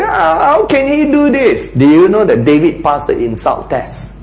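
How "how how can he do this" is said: high, incredulous "how can he"; pitched-up mock indignation; indignant complainer. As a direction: protest in disbelief.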